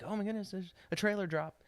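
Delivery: mock-excited child voice; imitating excited kids. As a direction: play the thrilled kid spotting a new trailer. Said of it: "that's what my kids do".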